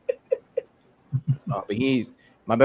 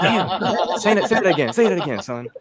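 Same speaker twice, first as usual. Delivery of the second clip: laughing through a higher mock dad voice; 8 semitones higher than usual. The dad tells his son to say it again, laughing. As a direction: giggle out the dad's line, pitched up.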